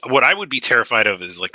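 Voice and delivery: ordinary talk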